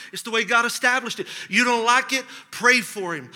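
normal talking voice

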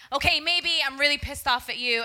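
retold blow-up at a friend, half-acted; mock blow-up. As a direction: let the anger burst out. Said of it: "like, blow up".

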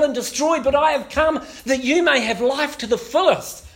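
loud, high preaching cry; rising prophetic call. Acted. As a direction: ring it out as God's summons. Voicing God's prophetic call.

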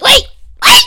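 high, loud, urgent yelp; stressed outburst. Real, not acted. Contestant stalling before her final answer, stressed yelp. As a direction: cry out under real game pressure. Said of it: "I was so stressed".

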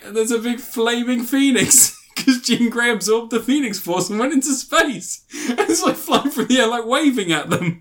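high-pitched telling through laughter; can barely get it out for laughing; cracking up. Real, not acted. Reviewer describing the silly ending, cracking up.

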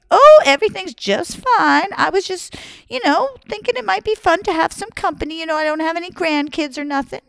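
put-on high, falsely sweet old lady voice. Old woman lures Jackie through his mother, high sweet old lady. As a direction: act innocent and lonely.

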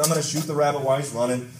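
ordinary talk, nothing marked

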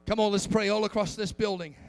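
loud, rising preacher's call to the congregation; full-force preaching. Acted. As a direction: build it up and preach to the back row.

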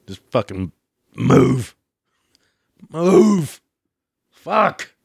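yelling move. Retold or imitated. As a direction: shove through yelling. Shoving through crowd, yelling.

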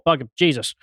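ordinary talk, nothing marked

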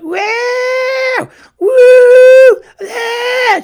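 very high, loud mock wailing; dying goat wail. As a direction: shriek it out like a dying goat, imitating a distraught fan. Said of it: "She sounded like a dying goat".

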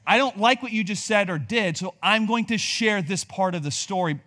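hammed-up petty adult; resentful adult.

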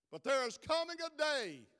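loud, driving sermon cadence; preaching at full force. Acted. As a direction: preach it to the rafters.

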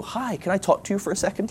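high, timid-sounding mock greeting; awkward approach. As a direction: approach a stranger sheepishly. Imagined awkward approach to stranger.

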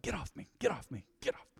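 lightly voiced mom fending off clinging kids; soft, lightly acted mom brush-off; exasperated mom. Mom to kids clinging in the store, mild exasperation.

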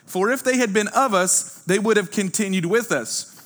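loud, high, slowed scripture proclaimed; fervent preaching. Acted. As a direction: preach it to the room, slowing on the key words.

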